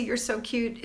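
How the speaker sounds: usual speaking voice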